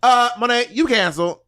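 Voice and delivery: high-pitched impression of her cadence; mock scolding in a borrowed high voice; Asia O'Hara. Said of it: "try to talk like Asia".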